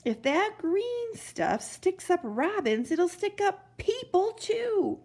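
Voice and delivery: high, slow fretting; anxious bell ringer; worried old man. Acted.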